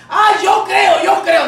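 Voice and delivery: high, loud mock declaration; mock profession. Preacher mimicking empty believers, mock profession. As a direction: parrot a glib believer, loudly.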